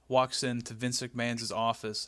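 ordinary delivery

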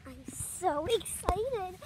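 usual speaking voice

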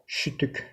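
Şütük whispered, with SH and Ü sounds; German pronunciation. Shown as a demonstration. Teacher modelling Şütük quietly for the class. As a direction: whisper Şütük, SH at the start, Ü vowels. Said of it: "Telaffuzu şütük".

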